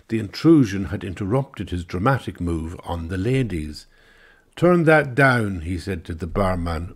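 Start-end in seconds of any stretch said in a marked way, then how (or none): 4.6-5.6 slow, higher, louder command; loud order. Acted. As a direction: snap at the barman. Englishman orders barman.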